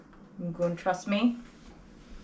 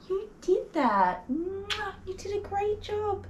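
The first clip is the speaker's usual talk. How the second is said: much higher, slow cooing praise; 13 semitones higher, slower than usual. Real, not acted. Groomer cooing proudly at her brave dog.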